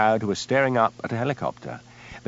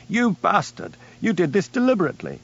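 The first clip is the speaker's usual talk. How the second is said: higher, raging accusation; screaming in fury; 9 semitones higher than usual. A narrator's reading. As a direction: hurl it at him.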